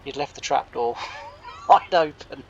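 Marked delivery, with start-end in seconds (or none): 1.3-2.4 laughing while talking. Real, not acted. Storyteller revealing the trap door blunder, laughing. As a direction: burst out louder through laughter.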